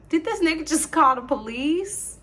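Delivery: can't believe what she just heard; shocked disbelief. Real, not acted. Hears him call 911.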